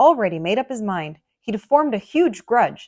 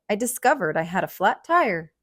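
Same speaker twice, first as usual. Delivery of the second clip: ordinary delivery, nothing marked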